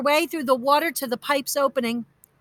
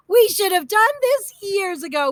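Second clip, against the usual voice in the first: whooping; 6 semitones higher, 3 dB louder than usual. A narrator's reading. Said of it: "whooped Aunt Hazel".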